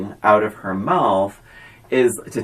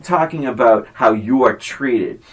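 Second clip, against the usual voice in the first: imitated furious fiancé, pitched up; mock angry tirade; 4 semitones higher than usual. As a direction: act out his indignant rant, punching the swearing. Acts out fiancé's angry retort.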